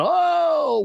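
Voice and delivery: high drawn-out "oh", mimicking the yell; high-pitched imitation of an excited broadcast yell; imitated excited yell. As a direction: mimic a commentator yelling out on a big play. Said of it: "he's yelling out".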